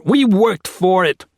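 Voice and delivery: slow, high, loud scream. A narrator's reading. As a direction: scream it, every word dragged out. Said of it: "Max screamed".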